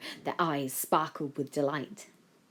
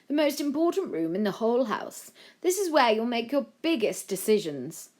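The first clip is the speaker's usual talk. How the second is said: lightly voiced official, higher; Jenkins the aide; 8 semitones higher, 4 dB louder than usual. A narrator's reading. Jenkins shows the new Prime Minister the cabinet room, lightly formal. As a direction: show round with a hint of ceremony.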